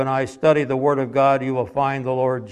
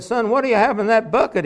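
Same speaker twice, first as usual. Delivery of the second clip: higher voice for the curious man; the man asking; 8 semitones higher, 4 dB louder, faster than usual. Retold or imitated. Preacher voicing a man asking a boy. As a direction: voice the stranger questioning the boy.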